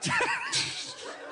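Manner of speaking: high-pitched breaking into laughter. Real, not acted. Comic amused by failed accent, high laugh. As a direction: crack up at your own attempt.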